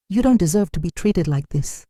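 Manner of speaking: ordinary delivery, nothing marked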